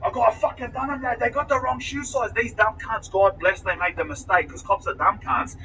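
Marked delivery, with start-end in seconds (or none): 0.0-2.3 high, agitated retold outburst without shouting; jumping-up-and-down excitement. Suspect retelling his outburst at the police setting him up, excited. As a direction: run on in high-pitched indignant triumph. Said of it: "I'm jumping up and down".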